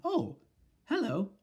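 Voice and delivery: high, bright-sounding surprised greeting. Acted. Grandmother answering the witch, high and casual. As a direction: sing out a cool hello. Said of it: "grandmother's high voice".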